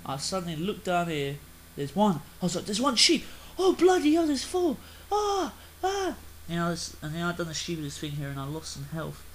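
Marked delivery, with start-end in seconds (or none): 2.7-4.8 high, loud burst of mock amazement; excited outburst. Gamer reliving spotting sheep, excited outburst. 5.1-6.2 two very high yelps; high excited squeals. Retold or imitated. Gamer reliving spotting sheep, high excited squeals.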